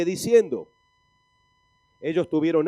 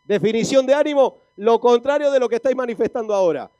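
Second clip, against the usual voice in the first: loud, higher-pitched preacher's definition; loud rousing proclamation; 6 semitones higher, 10 dB louder than usual. Real, not acted. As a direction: proclaim it forcefully to wake up the congregation. Repeats it loudly to rouse congregation.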